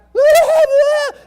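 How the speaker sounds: high, loud frantic shriek; frantic scream. Shown as a demonstration. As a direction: scream like a panicked mother. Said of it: "screaming and frantic".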